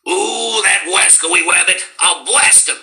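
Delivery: gleeful hunter chasing the rabbit; Elmer Fudd. Retold or imitated. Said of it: "Do you also do Elmer Fudd?".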